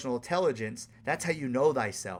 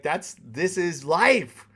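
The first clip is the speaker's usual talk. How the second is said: slow, louder emphasis on life; slow acceptance; 4 semitones higher, 6 dB louder, much slower than usual. Real, not acted. Telling viewers dating is life, slow and firm. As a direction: draw it out with calm conviction.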